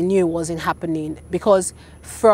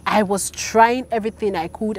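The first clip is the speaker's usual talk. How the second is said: ordinary talk, nothing marked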